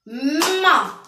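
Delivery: loud "Ma" with a long hummed m; "Ma" stretched so the m stands out; lengthened M sound. Shown as a demonstration. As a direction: hold the m before opening into "Ma". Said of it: "où j'entends le son".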